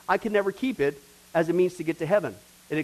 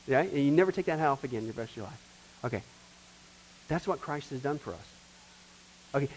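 ordinary talk, nothing marked

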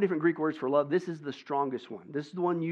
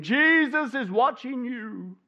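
slow, high, louder, eerie call from the dark; disembodied voice; 9 semitones higher, 6 dB louder, much slower than usual. A narrator's reading. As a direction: stretch each word like an unseen voice. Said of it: "strange disembodied voice echoed from the dark".

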